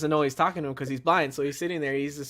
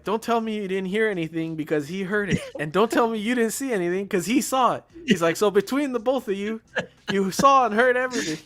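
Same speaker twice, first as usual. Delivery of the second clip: higher and louder, building; mock angry cop; 8 semitones higher, 6 dB louder than usual. Cop scolds both witnesses, a building hammed-up rant. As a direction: rant at the two suspects.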